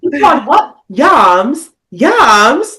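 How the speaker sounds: hammed-up shocked British disbelief; mock shocked British. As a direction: ham up British kids' shock at yams. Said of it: "They're like".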